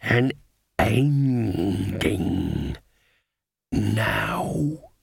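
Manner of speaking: halting growl, each word dragged out; a wolf struggling to form human words; wolf's growl. A narrator's reading. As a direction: growl it out brokenly, pleading. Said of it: "it growled".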